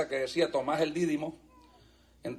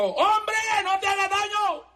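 preacher acting out Paul's cry; Paul shouting; 12 semitones higher than usual. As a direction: call out across the dark prison to save him. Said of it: "Pablo gritó y dijo".

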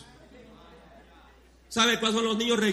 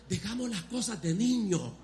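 ordinary talk, nothing marked

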